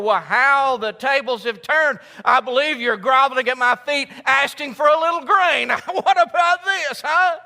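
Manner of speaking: loud, high and laughing triumph; mock-smug brother, laughing and loud; smug gloating. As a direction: savor the turned tables. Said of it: "I'd have said".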